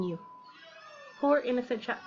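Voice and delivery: usual speaking voice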